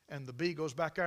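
ordinary talk

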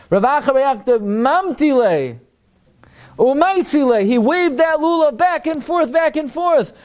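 high-pitched, insistent exposition; impassioned Talmud teaching. Acted. Teacher expounding the lulav Gemara to students, impassioned. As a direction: drive each point home, pitched well up.

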